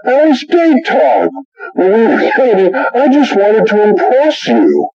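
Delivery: very high-pitched mock protest; defensive wife. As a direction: imitate a wife insisting on her excuse, high voice. Wife defends herself in acted sitcom quarrel.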